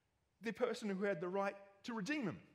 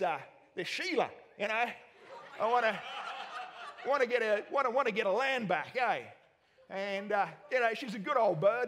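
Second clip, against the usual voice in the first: a hint of a broad Australian accent, louder; Aussie accent; 3 semitones higher, 4 dB louder than usual. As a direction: play Bozo as a laid-back Aussie bloke. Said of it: "With the Aussie accent".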